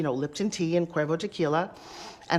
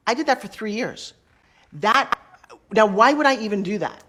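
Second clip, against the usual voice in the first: usual speaking voice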